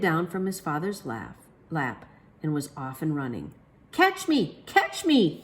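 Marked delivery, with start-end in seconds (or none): 3.9-5.4 put-on high, loud child yelling; excited shout. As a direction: shout it like a kid at play. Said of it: "he shouted".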